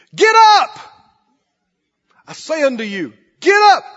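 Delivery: high, loud preaching; preaching at full force. Acted. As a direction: drive the story home at full voice.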